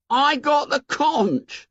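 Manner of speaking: high, slow wounded schoolboy voice; hurt Piggy. Acted. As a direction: say it like a boy stung by being ignored. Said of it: "said Piggy in a hurt voice".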